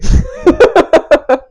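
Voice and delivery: high, loud burst of laughter; real helpless high laugh; laughing fit. Real, not acted. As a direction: crack up at your own joke. Cracks up after saying six seven.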